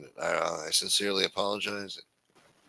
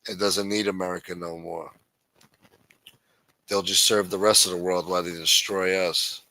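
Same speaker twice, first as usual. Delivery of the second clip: ordinary talk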